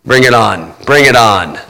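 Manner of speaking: low, steady challenge. Acted. Jesus on the cross daring the devil, low defiance.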